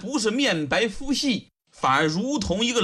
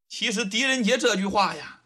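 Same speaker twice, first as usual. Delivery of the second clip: plain reading voice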